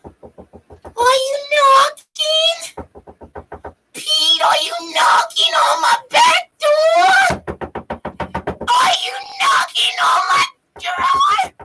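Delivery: very high, slow cartoon wail; Lois. Cartoon wife calling to her husband, high drawn-out wail. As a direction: draw out each word in a screechy put-on voice. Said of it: "They had a picture of Lois".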